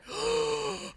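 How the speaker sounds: sharp high gasp; shocked gasp. Real, not acted. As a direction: gasp in horror. Shocked watching live.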